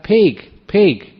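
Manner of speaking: high, loud retold yell; a farmer's high mock shout of warning. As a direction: bark it out high across the field to warn the driver. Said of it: "He just shouted out".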